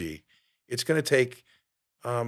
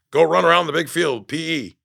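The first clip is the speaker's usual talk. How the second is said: mock coach bark; 4 semitones higher, 6 dB louder than usual. Quoting the gym coach.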